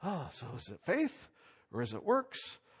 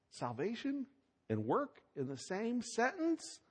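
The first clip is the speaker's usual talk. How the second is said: higher, slow, quiet incredulous question; mock disbelief; 8 semitones higher, 6 dB quieter than usual. Preacher feigning shock at the pairing, incredulous. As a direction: sound genuinely puzzled, half mock. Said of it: "This is starting to bother me".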